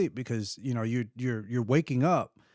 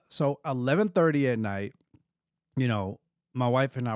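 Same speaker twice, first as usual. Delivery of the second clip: usual speaking voice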